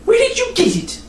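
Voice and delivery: lightly voiced little nun, high; a small girl's eager question; high child voice. The nun asks curiously.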